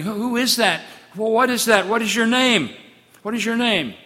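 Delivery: a touch of fear in the questions; mock trembling. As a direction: Moses trembling before the burning bush.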